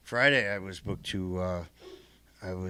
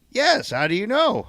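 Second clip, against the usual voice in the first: high, loud quoted astonishment; surprised reply; 14 semitones higher, 8 dB louder than usual. Retold or imitated. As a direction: voice the shop owner's surprise, pitched way up. Voices shop owner's surprised reply.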